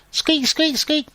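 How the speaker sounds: very high squeaky mouse squeak; teacher imitating a mouse's high squeak. Shown as a demonstration. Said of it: "Squeaky, like a mouse".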